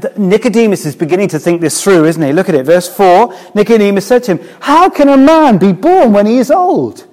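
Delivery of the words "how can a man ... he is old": high, loud, baffled disbelief; hammed-up astonishment, pitched right up; incredulous question. Preacher voicing Nicodemus questioning Jesus about rebirth, incredulous.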